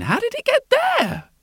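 cartoon princess. Princess voiced, far high.

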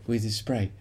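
plain reading voice

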